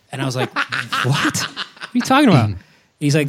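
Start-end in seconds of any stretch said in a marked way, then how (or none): 0.5-2.5 half-laughing, pitched up, baffled; laughing disbelief. Retold or imitated. Dad laughing at his son's hat rack talk, baffled. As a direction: laugh through the question in confusion.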